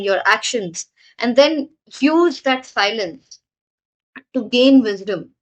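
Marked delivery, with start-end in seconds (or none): none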